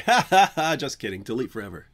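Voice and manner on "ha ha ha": high, loud joking cackle; loud "ha ha" laugh. Pretend. Joking about crying over deleted videos, loud laugh. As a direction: burst out in big ha-has.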